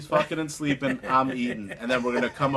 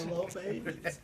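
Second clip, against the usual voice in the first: soft echo; 4 semitones higher, 11 dB quieter than usual. Retold or imitated. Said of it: "Every week he gets me with the".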